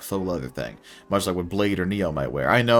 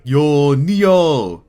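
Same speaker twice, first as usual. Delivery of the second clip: loud, high hail across the driveway; calling out; 7 semitones higher, 10 dB louder than usual. Acted. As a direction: shout out a greeting to a passenger. Cabbie calls out greeting.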